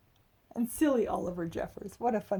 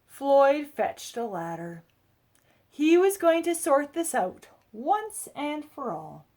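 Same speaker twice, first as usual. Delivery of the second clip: expressive read-aloud storytelling; picture book narration; 5 semitones higher, 11 dB louder, slower than usual. A narrator's reading. Adult reading a picture book to children, lively storybook voice. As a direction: tell it like a bedtime story for kids.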